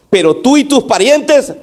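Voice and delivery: high-pitched pointed warning; stern rebuke, held in suspense; reproachful tone. Acted. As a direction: raise the pitch and accuse. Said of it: "eso fue en el siguiente tono".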